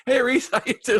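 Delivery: very high, chirpy hello breaking into a laugh; laughing greeting. Retold or imitated. Star coming over to greet the crew guy, laughing hello. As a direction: greet an old friend with a laugh. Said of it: "makes a point to come over and say".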